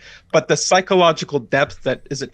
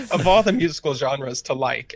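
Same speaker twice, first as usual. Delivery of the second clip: ordinary talk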